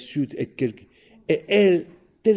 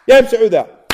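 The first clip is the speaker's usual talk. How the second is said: calling out; 10 semitones higher, 12 dB louder than usual. Acted. Old man calls his wife.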